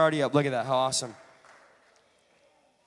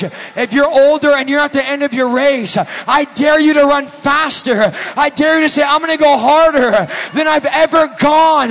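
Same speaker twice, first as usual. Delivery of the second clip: loud, high, slowing preacher's shout; a sermon climax rising to a roar; full-force preaching; 7 semitones higher, 15 dB louder, slower than usual. Acted. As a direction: drive it home like a revival preacher.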